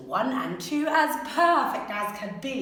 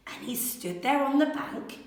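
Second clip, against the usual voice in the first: ordinary delivery, nothing marked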